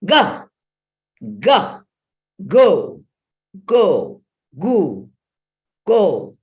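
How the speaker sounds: "Ga" and "Go" fired out high and loud; powerful ga. Shown as a demonstration. Voice teacher showing the strong version. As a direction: own the power of the sound. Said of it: "They're afraid of that power".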